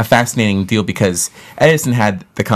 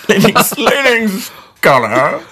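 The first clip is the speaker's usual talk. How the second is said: mock pompous; 13 semitones higher, 3 dB louder, much slower than usual. Mocking the leading scholar title.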